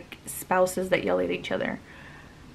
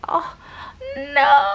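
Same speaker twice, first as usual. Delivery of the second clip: sudden high cry of dismay; oh no, something just spilled; real startled cry; 7 dB louder than usual. Real, not acted. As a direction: jump up to a yelp of alarm. Something spills mid-story.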